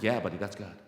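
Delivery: low, unfazed, falling answer; calm confirmation. Retold or imitated. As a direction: confirm it casually, completely unfazed. Sponsor calmly confirms, after stunned question.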